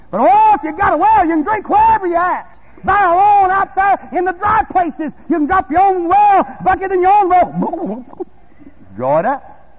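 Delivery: loud, high, fervent preaching cry; full-force preaching. Real, not acted. Preacher urging the congregation to draw from their own well, full-force preaching.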